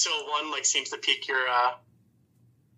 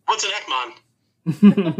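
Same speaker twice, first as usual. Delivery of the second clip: slow, puzzled robot voice; slow robot question; 3 semitones higher, 5 dB louder, much slower than usual. Acted.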